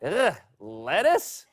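slow, mock-disgusted question rising at the end; hammed-up revulsion; disgusted fan. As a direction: recoil from it in comic distaste. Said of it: "Said one fan".

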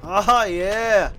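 loud happy yell; excited cheer. Real, not acted. Cheering after a kill.

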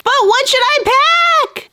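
high-pitched panicky wail; helpless whine. Acted. Girl asking friends what to pack, in a panicky whine.

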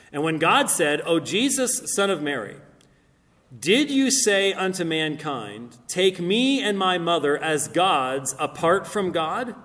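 loud, emphatic declaimed verse; scripture reading. Acted. Speaker reads God questioning Jesus from the Quran, declaimed. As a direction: proclaim the holy text to a listening hall.

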